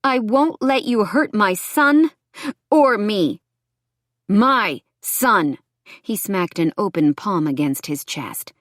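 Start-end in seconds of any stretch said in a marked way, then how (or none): 0.0-3.4 slow, raised, higher-pitched defiance; defiant. A narrator's reading. Woman protects her son from her ex, defiant. 4.3-5.6 loud, higher, emphatic claim; angry claim. A narrator's reading. Ex-boyfriend claims the baby, angry.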